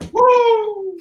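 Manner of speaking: long, very high whoop; sustained celebratory woo; high cheering woo. Real, not acted. Host cheering the guests in, high cheering woo.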